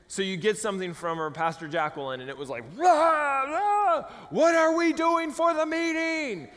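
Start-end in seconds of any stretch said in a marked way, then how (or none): 2.8-6.5 high, drawn-out roaring shout; yelled all-caps email. Retold or imitated. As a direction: read a huge-font email as a full mock roar. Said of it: "bold red letters".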